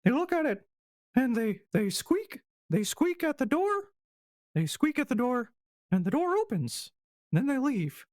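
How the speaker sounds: high, squeaky mouse voice, haltingly explaining; dim little mouse. Acted. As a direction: pipe up as a simple-minded mouse.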